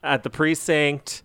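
ordinary talk, nothing marked

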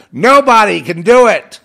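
loud, high, forceful declaration; preaching shout. Real, not acted. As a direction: hammer it out at full force. Preaching climax, no one saves.

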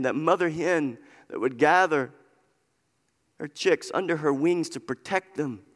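ordinary delivery

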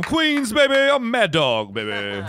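high, loud, brash radio host voice; Mad Dog impression. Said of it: "[name] impersonates me all the time".

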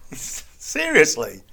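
slow, drawn-out disbelief through a laugh; incredulous laugh. Retold or imitated. Retelling his answer to the police officer, laughing in disbelief. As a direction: stretch the word out, still laughing. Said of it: "I went".

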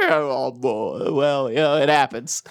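low, resigned sigh of words; deflated mock acceptance. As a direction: shrug it off in abrupt, subdued resignation. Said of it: "all the stages of grief".

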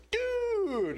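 thrilled dude over the ice cream; delighted dude. Acted.

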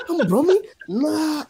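high, loud hammed-up Birmingham accent; mock Brummy accent. Mocking how Birmingham people sound, hammed-up Brummy accent. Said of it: "the accent".

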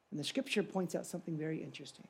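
ordinary talk

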